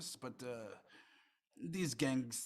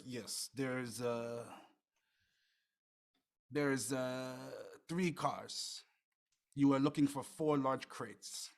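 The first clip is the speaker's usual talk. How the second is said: put-on a middle-aged Russian informant in a so-so Russian accent; lightly done Russian accent; weak Russian accent; slower than usual. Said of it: "my Russian accent is, how do you say, not so good".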